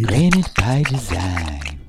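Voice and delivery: slow, drawn-out ad tagline; slow slogan voice. Retold or imitated. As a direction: savour it like an ad slogan. Repeats a slogan slowly.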